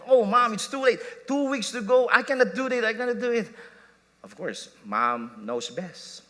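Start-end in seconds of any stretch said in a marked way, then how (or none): 0.1-3.5 higher and louder whiny protest; a kid pleading that it's too late; frustrated child. Retold or imitated. Son protesting to his mom about the recital, frustrated and whiny.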